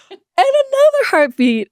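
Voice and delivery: sudden high surprised announcement. Retold or imitated. Sonographer to the couple, surprised. Sonographer finds second heartbeat.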